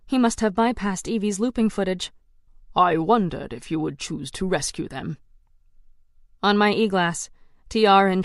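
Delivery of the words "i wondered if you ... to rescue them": lower, calm taunting king; a hint of a smug tyrant; cool villain.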